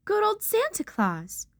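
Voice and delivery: ordinary delivery, nothing marked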